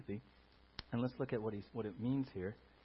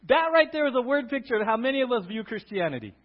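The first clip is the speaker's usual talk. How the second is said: usual speaking voice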